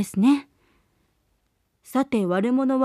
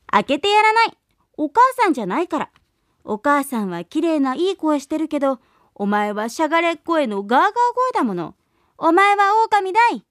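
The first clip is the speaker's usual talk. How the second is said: put-on kids shouting; 3 semitones higher, faster than usual. Young goats shout at the wolf at the door, defiant yell. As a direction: yell back at the wolf, fast and sure. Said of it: "と叫びました".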